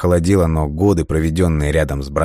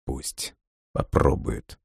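slow, much softer self-reassurance; quiet inner thought; 4 semitones lower, 8 dB quieter, much slower than usual. A narrator's reading. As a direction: say it slowly to yourself, barely aloud. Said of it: "мысленно успокаивал себя Лесков". (тихо и медленно, про себя)

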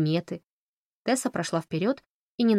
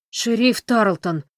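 slow, loud reproachful gasp; 4 semitones higher, 6 dB louder, slower than usual. A narrator's reading. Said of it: "укоряюще ахнул Кенни".

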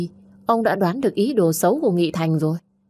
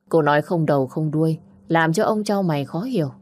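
ordinary delivery

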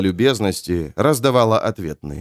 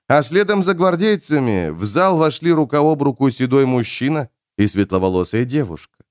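usual speaking voice

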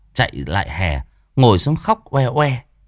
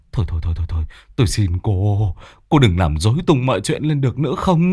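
whimpering plea; faster than usual. Acted. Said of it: "mếu máo".

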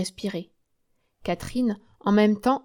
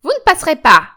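high, loud blocking shout; shouted challenge; 8 semitones higher, 12 dB louder than usual. Acted. Joséphine rushes to block the door, shouting. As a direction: bar the way with your voice. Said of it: "s'élança en criant".

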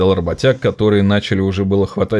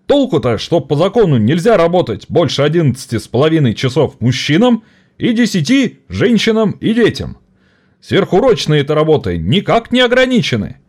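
higher, rousing speech to the crowd; rally the workers against the bosses; agitated strike orator; 9 semitones higher, 4 dB louder than usual. Acted. (пламенный оратор стачки)

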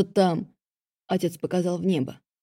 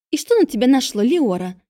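indignant; 5 semitones higher, 4 dB louder than usual. A narrator's reading. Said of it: "возмутилась сводная сестра".

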